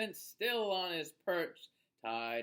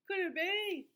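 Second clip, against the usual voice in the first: much higher, bright amazed question; delighted wonder; 9 semitones higher, 4 dB louder than usual. Acted. Asking children in a high delighted voice what hatched. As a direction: burst out with delight at the surprise.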